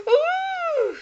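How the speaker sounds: high, loud excited squeal. A narrator's reading. Hildy thinks the prince appeared, high excited squeal. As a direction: squeal with delight. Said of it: "squealed Hildy".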